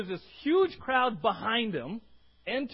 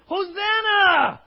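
long, much higher and louder shout; crowd shout; 9 semitones higher, 11 dB louder, much slower than usual. Retold or imitated. Preacher voicing the jubilant crowd, huge shout. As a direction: roar it out as a cheering crowd. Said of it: "There's this mixture".

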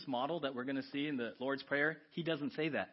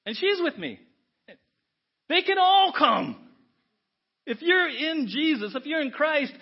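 preaching climax; 9 semitones higher, 9 dB louder than usual. Real, not acted. Preacher proclaiming welcome for all believers, fervent. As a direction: build to a joyful climax.

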